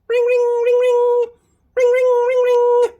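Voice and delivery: very high, loud sung ringing; high loud ringtone; phone ring. Acted. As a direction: ring ring like a telephone.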